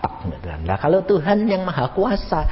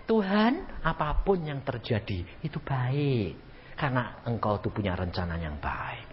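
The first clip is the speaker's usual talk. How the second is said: much softer, lower, slower surrendering prayer; trusting prayer; 6 semitones lower, 10 dB quieter than usual. Shown as a demonstration. Believer accepting God's plan, softly trusting. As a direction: pray it quietly, fully trusting. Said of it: "Kamu harus mengatakan".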